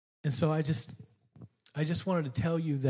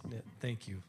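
ordinary talk, nothing marked

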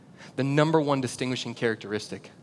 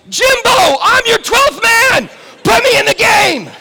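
much higher and louder yell, laughing at the end; excited shout; 13 dB louder, slower than usual. Retold or imitated. Fan yelling to coach Jimbo from the bleachers, high and loud. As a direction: beg the coach like a fan in the stands. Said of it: "and I'm like".

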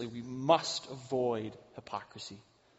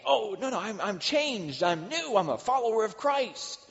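high, prim protest of innocence; mock pious protest; 8 semitones higher, 4 dB louder than usual. Preacher mocking a churchgoer's defense, high and prim. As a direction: put on a pious denial, higher.

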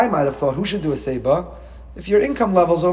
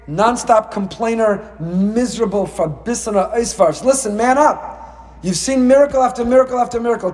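imagined scolding; 7 semitones higher than usual. Pretend. God scolding the complaining Israelites, high exasperated rant. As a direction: scold the ungrateful people, building up. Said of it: "could have screamed at them and said".

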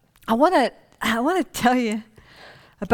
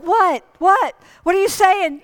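confused doctor; 9 semitones higher, 4 dB louder than usual. Retold or imitated. Doctor confused by old man.

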